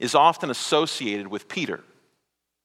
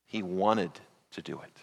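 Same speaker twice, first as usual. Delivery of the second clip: said again much softer and lower, wanted leaned on; hushed repeat; 8 semitones lower, 9 dB quieter than usual. Real, not acted. Preacher to congregation, repeating softly for weight, hushed. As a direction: drop to a near hush on the repeat.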